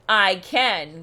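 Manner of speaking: low, loud growling retort; growled. A narrator's reading. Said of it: "growled the bear".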